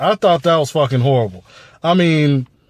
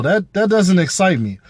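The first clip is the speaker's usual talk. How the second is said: ordinary delivery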